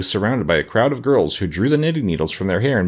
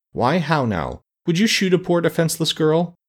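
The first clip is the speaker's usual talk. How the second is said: reproachful; 9 semitones higher than usual. Acted. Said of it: "said reproachfully".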